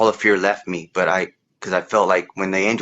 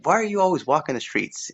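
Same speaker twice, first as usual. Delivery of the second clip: put-on high, concerned old-lady question; a lightly voiced old woman asking a boy; 8 semitones higher than usual. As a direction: ask it the way the kind neighbour did.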